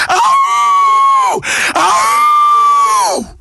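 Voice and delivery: very high, sustained wolf-like howl; animal howl. Acted. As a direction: throw your voice up and howl it out long. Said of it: "howl like an animal".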